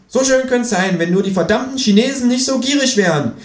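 high, pert kangaroo voice lecturing; sassy kangaroo holding forth; cheeky kangaroo. Acted. Kangaroo mocking the economist on live TV, high sassy voice.

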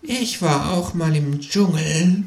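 high, slow, dreamy kangaroo; faraway kangaroo voice, drawn out; entranced murmur. A narrator's reading. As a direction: murmur as if lost in a trance. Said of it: "murmelt das Känguru entrückt".

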